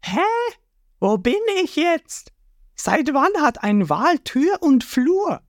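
high, slow confused cartoon voice; puzzled boy hero. Ruffy lost in a corridor inside the whale, baffled. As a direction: wonder aloud, baffled.

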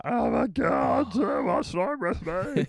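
slow, high, loud cry of despair; mock exasperated shout. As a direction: drag it out as if the sneezing won't stop. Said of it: "and you're like".

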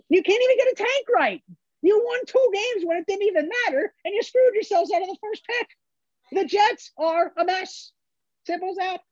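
very high-pitched, incredulous outburst; real exasperation, straining high; exasperated rant. Real, not acted. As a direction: let the frustration push your voice way up. Exasperated rant about the Jets.

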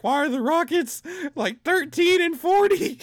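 high, loud disbelieving cry; shocked disbelief. Retold or imitated. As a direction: shriek it in horror. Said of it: "I was like".

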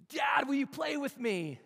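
put-on high, loud, drawn-out child's whine; little son. Son Judah calling to his dad, a high loud whine. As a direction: play the kid who won't sleep. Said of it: "out comes my son Judah".

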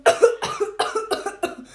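loud hacking cough; harsh coughing fit. Real, not acted. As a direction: cough hard from the spice. Coughing after spicy food.

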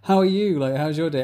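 high, bright-eyed friendly chatter; mock the cheery small-talk voice; imitating a sociable person; chirpy small talk. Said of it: "I don't normally go".

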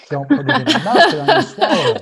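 high and laughing, voicing the little boy; laughing while quoting. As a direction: quote the scared kid through a laugh. Voicing son's fearful thoughts, laughing.

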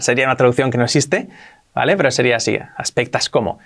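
usual speaking voice

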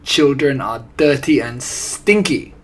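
loud, emphatic witch pronouncement; commanding villainess outcry; screamed. A narrator's reading. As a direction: declare it forcefully with disgust. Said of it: "screamed the Grand High Witch".

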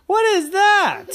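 very high, loud laughing squeal; laughing disbelief. Acted. Terry sees the birthday candle, high laughing squeal. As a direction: crack up at the tiny candle. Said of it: "number five candle".

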